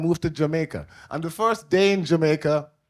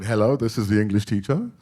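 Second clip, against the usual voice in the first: low, proper British phone voice; proper English father; 6 semitones lower than usual. Retold or imitated. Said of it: "he spoke properly".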